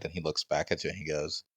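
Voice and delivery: normal talking voice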